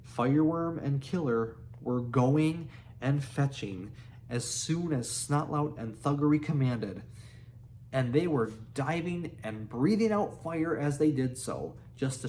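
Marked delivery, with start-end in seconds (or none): none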